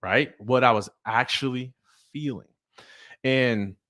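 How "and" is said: usual speaking voice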